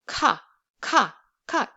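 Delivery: "Ka" with a strong puff, as in kill; short airy "ka"; aspirated k. Shown as a demonstration. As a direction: push air out after the k in "ka". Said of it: "pronounced with a strong puff of air".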